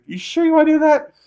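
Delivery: high, loud taunt; taunting dare. Retold or imitated. To the man squaring up, high, loud taunting dare. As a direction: dare him to try it. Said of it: "and I was like".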